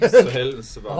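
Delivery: loud, whiny moan about the heat; whiny complaint. Acted. Complaining in character.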